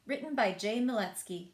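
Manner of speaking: plain reading voice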